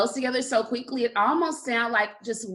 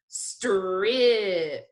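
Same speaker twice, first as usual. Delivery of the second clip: slow sounding out. Shown as a demonstration. Teacher repeating the word for spelling, slowly stretched. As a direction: say "Strip" very slowly. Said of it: "I'm gonna say it slowly again".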